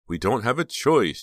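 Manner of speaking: usual speaking voice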